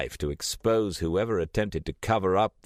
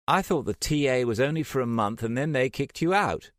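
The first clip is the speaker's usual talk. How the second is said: pointed, accusing question; higher-pitched mock accusation; 8 semitones higher than usual. A narrator's reading. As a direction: pin him down with it. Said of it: "Trish said in accusation".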